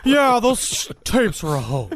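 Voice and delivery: lightly put-on disguised voice; mock crank-call delivery; Ted Koppel. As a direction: hoax caller faking someone else's voice. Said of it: "anything but his voice".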